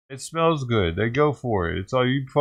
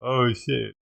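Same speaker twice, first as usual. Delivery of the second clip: usual speaking voice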